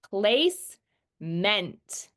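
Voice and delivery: placement very slowly in two parts, "place ... MENT"; sounded-out reading. Shown as a demonstration. Student just learning to read, placement sounded out as "place, ment". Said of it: "read these words based on sounds".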